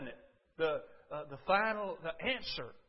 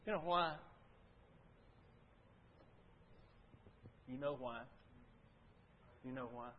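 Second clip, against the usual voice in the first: each "You know why?" softer and lower until nearly gone; asking again and again, quieter each time; fading repeat; 7 semitones lower, 12 dB quieter than usual. Acted. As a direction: let the question die away into a hush. Repeated rhetorical question, dropping away.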